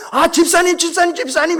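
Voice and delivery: high, loud, urgent mock call; acted calling out. Seoul churchgoer hailing a passing deacon, acted high loud call. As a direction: call across to someone, hammed-up.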